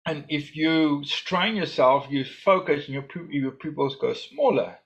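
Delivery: normal talking voice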